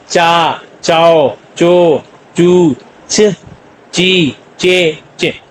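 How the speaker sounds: very slow, drawn-out चा चाओ चो चू च ची चे; teacher sounding out Korean ch syllables; syllable drill. Shown as a demonstration. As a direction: stretch each ch syllable for learners. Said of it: "तो चे की साउंड देगा".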